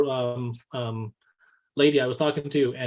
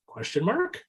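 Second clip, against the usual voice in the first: mock rising uptalk, unsure; imitating a trainee's unsure upward lilt; uptalk question; 7 semitones higher, slower than usual. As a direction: pitch it up like a question. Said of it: "they're like".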